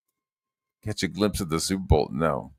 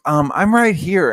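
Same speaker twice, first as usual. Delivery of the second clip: high, loud, stretched-out retort; hammed-up offended voice, slow; mock gatekeeper; 10 semitones higher, 7 dB louder than usual. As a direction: imitate an indignant check-in guy, pitched up. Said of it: "And he was like".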